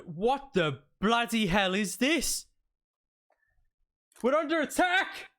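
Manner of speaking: high, alarmed sergeant's shout; yelling bloody murder. Acted. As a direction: raise the alarm at full volume. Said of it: "Stop yelling bloody murder".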